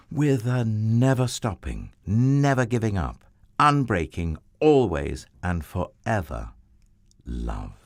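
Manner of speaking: fervent build about never-ending love; building emphasis. Acted. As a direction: pile up each phrase with growing force.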